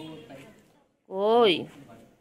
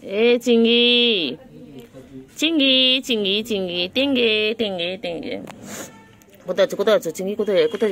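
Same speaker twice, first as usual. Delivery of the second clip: loud calls across the yard; loud repeated pet call; 2 semitones higher, 9 dB louder than usual. Real, not acted. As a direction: shout for the pet to come. Calling the shrimp pet loudly.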